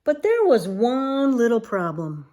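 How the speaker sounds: ordinary delivery, nothing marked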